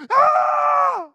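high, loud cry of alarm; a sudden yelp of panic; panicked shout. Retold or imitated. Disciple crying out as the storm hits, panicked shout.

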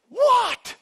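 high, loud acted shout; snapped phone answer. Parent answering a friend's call amid fighting kids, shouted. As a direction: explode on a single word.

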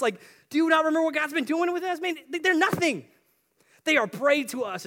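loud, high, insistent plea building in intensity; impassioned rallying. Acted. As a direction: argue them into courage at full force. Joshua and Caleb argue fervently back.

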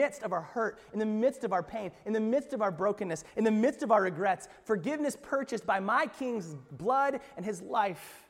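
rising preacher's cadence, slowing at the end; preaching build. Acted. Pastor preaching to the congregation, building fervour. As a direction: lift the congregation toward forgiveness. Preaching climax before altar call.

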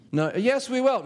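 mock indignant crowd; protesting people. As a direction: plead back at Moses.

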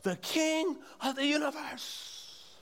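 high, slow, far-off madman proclaiming; hammed-up deluded king shout; madman screaming. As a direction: mock-scream like a deluded man on a chair. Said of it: "he's screaming at the top of his lungs".

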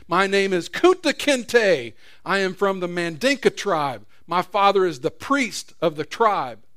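slow, loud, proud declaration; defiant proclamation. Acted. Captive proclaiming his name to his masters, slow and proud. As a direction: hold your name against the beating. Kunta resists while beaten.

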